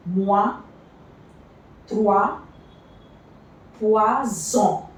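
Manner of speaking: "Moi", "toi", "poison" said with OI as wa, each word set apart; teacher sounding out OI words; OI as wa demo. Shown as a demonstration. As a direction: model each OI word clearly as wa. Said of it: "O I is pronounced as wa".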